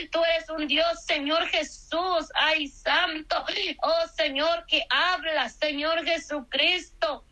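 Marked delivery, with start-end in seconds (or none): none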